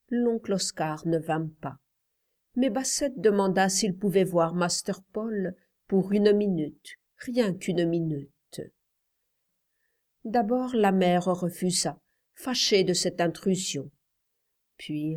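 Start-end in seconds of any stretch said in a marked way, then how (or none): none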